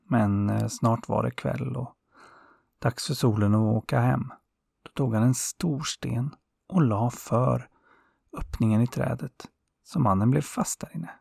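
plain reading voice

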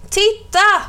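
put-on high excited shout of a child; high, loud kid's cry; children calling out. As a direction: yell it like a kid spotting something shocking. Schoolchildren shouting about blood.

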